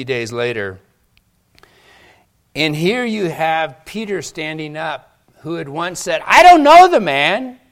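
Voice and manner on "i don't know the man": high, shouted denial; loud denial. Acted. Preacher re-enacts Peter's denial.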